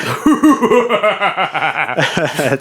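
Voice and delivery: hammed-up villain cackle; long mock-evil supervillain laugh. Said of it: "evil voice".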